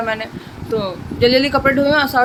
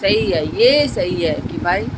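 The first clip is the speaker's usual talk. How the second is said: slow, low, dry sarcasm; sarcastic; 5 semitones lower, slower than usual. Retold or imitated. To Papa about the motor, slow sarcastic drawl. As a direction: drawl it out, mocking the situation. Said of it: "मैंने कहा".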